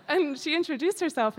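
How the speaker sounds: ordinary delivery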